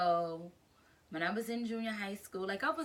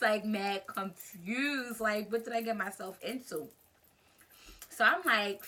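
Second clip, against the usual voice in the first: ordinary delivery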